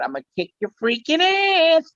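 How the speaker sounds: cartoonish tough-guy squeak; Pee-wee. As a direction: squeal the catchphrase in character. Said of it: "I came up with a part".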